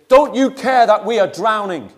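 loud, high frantic cry of fear; panicked shout. Retold or imitated. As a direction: yell it like men about to drown. Disciples wake Jesus in storm.

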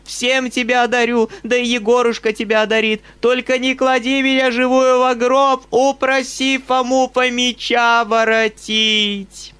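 high, loud wailing plea; old mistress wailing, bribing the governess; frantic old woman. A narrator's reading. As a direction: beg like your life depends on it. Old woman begging Nastenka.

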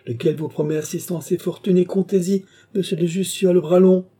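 high, mounting sarcastic assurance; forced, bitter smile. A narrator's reading. Rousseau mocking Jussieu's patronage to Gilbert, forced bitter smile. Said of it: "ajouta-t-il avec un effort grimaçant pour sourire".

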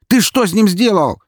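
high, loud furious yell. Acted. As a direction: scream the names in rage. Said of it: "закричал он".

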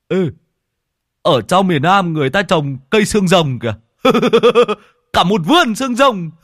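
to, cao, vừa nói vừa cười; gọi cả đám khoe phát hiện; reo lên thích thú. Acted. Lâm Sẹo reo lên. (giọng reo hò thích chí)

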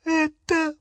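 high, halting whimper. A narrator's reading. Hermione being helped up by Ron, whimpering. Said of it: "проскулила Гермиона".

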